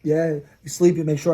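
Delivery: ordinary talk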